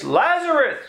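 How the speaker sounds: high ringing call, held long; commanding shout across a crowd; loud cry. A narrator's reading. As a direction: call the dead man out of the tomb. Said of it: "he cried with a loud voice".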